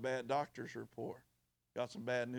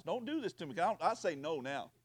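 ordinary talk, nothing marked

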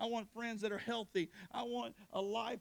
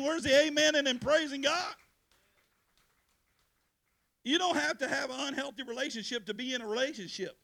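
loud preaching, building; full-force preaching; 6 semitones higher, 6 dB louder than usual. Acted. Pastor urging teenagers to leave bad relationships, preaching loud. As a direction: preach hard to the youth.

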